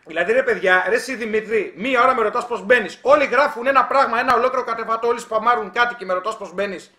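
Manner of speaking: real exasperation at a viewer; exasperated scolding. Real, not acted. Scolds viewer asking again.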